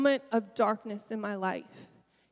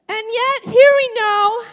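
much higher and louder, rising on yet; rousing cry; 11 semitones higher, 14 dB louder than usual. Real, not acted. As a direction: push through the tears with a big shout. Preacher's turn after breaking down.

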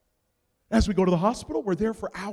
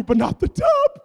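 much higher, louder plea on tub; pleading shout; 10 dB louder than usual. Retold or imitated. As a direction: beg it loudly. Pleading over his sanctuary.

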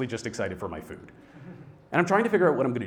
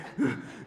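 wordless sobbing gasps; acted hitching cry breaths; nonverbal gasps; 4 dB quieter than usual. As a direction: suck in air as if crying. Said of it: "I'm just nonverbal".

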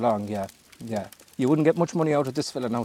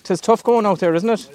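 calling out; 7 semitones higher, 6 dB louder than usual. Real, not acted. Shouting to boat across water.

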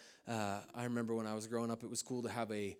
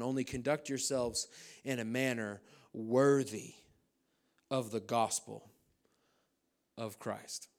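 slow scripture reading; slower than usual. Acted. Reads key verse slowly.

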